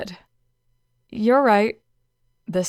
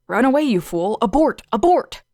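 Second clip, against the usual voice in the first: panicked yell rising higher; inner panic; 4 semitones higher, 6 dB louder than usual. Acted. Student panicking at a stranger, rising yell. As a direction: scream at yourself to flee.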